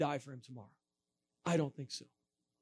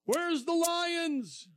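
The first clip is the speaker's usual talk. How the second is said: high, slow mock heckle; heckler's yell; 10 semitones higher, much slower than usual. Atheist heckler jeering at the preacher, slow mocking yell. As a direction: jeer it across the crowd. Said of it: "I heard somebody yell".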